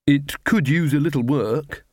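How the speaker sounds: slow, reluctant admission; conceding. A narrator's reading. Ella admits her nickname has faults, conceding. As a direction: grant it slowly. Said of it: "she conceded".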